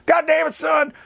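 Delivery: high, strained mock outrage; Sam Shepard. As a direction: act out a hammed-up boss calling off the mission. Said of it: "Sam Shepard's like".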